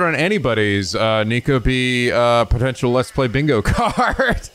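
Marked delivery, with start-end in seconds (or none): none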